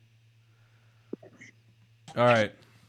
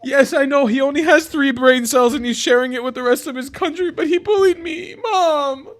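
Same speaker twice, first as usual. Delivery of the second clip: put-on higher, whiny kid calling for mom; childish tattling; 10 semitones higher, 4 dB louder than usual. Streamer tattles to mom about the mean Egyptian guy, childish whine. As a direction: play the bullied child complaining to mommy.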